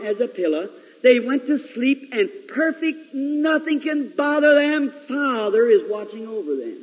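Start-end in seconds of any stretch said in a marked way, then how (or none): none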